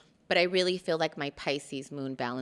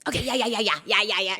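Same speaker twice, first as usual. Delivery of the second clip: soothing dad; 5 semitones higher, 5 dB louder, faster than usual. Retold or imitated. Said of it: "he's like".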